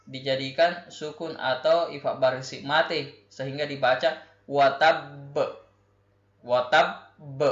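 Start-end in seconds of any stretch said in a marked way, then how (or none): 4.4-7.4 "tabb" ending on a bounced, echoing b; bouncing final b. Shown as a demonstration. Teacher shows the class the echoing b. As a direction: let the b in "tabb" rebound after the stop. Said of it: "yang dimaksud dengan memantulkan suara adalah B-nya".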